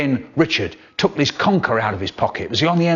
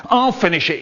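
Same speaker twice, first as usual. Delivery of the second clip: higher, keener grab for the food; greedy eager dad; 7 semitones higher than usual. Acted. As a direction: snap up the leftovers eagerly. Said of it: "you bet he said that".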